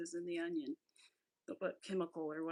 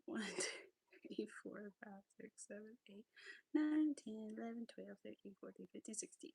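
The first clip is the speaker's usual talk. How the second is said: counting under her breath; whispered counting; 4 dB quieter than usual. Real, not acted. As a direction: count softly to yourself.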